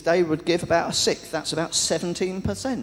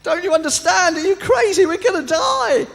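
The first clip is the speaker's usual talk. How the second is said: very high, loud, desperate shout; castaway screaming at his calm friend; frantic yelling; 8 dB louder than usual. Retold or imitated. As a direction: yell at him in disbelief. First man yelling at calm friend.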